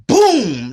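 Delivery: loud mouth-made gunshot; a punchy shouted boom. Retold or imitated. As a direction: gunshot sound. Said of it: "imitated gunshot".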